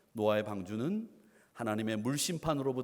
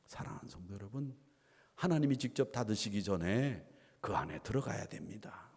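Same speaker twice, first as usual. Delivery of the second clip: ordinary talk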